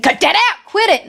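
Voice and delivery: high, loud, sharp telling-off; annoyed foreman. Acted. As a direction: yell at the boys to stop fooling around. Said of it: "and he said".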